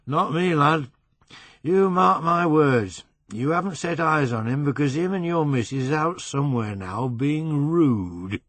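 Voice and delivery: put-on drunk man teasing Brian, West Riding accent; emphatic West Riding accent. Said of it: "He had a flat West Riding accent".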